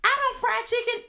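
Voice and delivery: imitating mom. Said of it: "She said".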